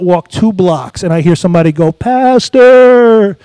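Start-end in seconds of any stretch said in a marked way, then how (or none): 1.9-3.4 loud, high call from down the street; drawn-out hailing call. Retold or imitated. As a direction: shout to catch his attention. Someone calling from a cafe.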